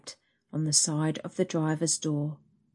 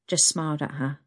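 usual speaking voice

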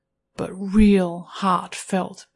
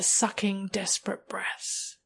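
plain reading voice

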